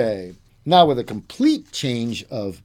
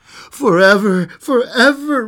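passionate vow; 7 semitones higher, 8 dB louder than usual. Acted. Lover passionately repeats his vow.

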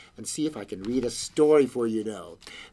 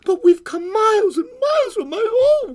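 put-on very high, loud child's wail; little girl crying out; 8 dB louder than usual. A narrator's reading. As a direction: wail in protest like an upset child. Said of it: "cried the little girl".

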